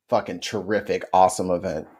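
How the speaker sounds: dry sarcastic praise. Acted.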